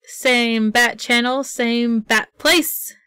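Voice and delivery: slow, drawn-out announcer sign-off; catchphrase sign-off. Real, not acted. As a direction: stretch it like a show catchphrase.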